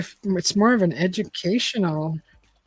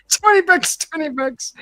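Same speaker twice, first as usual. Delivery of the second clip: high, loud auctioneer call; 8 semitones higher, 3 dB louder than usual. Acted. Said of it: "Did I sound like an auctioneer?".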